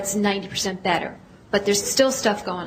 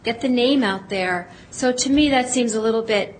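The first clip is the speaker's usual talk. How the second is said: normal talking voice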